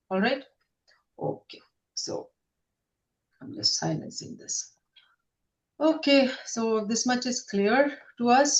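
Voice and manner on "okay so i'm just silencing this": whispered aside. Real, not acted. Aside while muting something.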